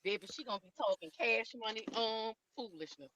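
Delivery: distant, muffled phone voice. Real, not acted. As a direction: sound muffled, far from the mic. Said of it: "muffled or far away".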